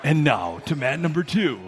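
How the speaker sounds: put-on strip club announcer; smooth hype announcer impression; stripper DJ voice. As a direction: imitate a strip club DJ calling a match. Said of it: "in his stripper voice".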